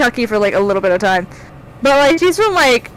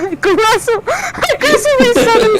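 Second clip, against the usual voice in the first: laughing; 11 semitones higher than usual. Real, not acted. Laughing at friend's story.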